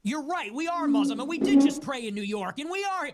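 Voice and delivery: mocking, loud high voice caricaturing a confession. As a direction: play him gloating loudly as he admits it. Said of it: "He goes".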